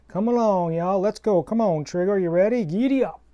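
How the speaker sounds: rally the horse in a hammed-up Southern accent; phony cowboy accent. Cowboy host calling his horse Trigger, Southern accent. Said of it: "I dropped my phony cowboy accent".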